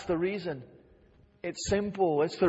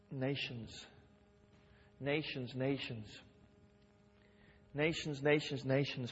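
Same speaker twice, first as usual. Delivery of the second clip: soft, dropping each repeat of Nations; preacher murmurs the word to the church, hushed; hushed repetition; 3 semitones lower, 9 dB quieter than usual. Acted. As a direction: let the word sink in, softly.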